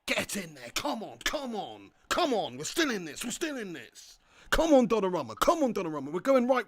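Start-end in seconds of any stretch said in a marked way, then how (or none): none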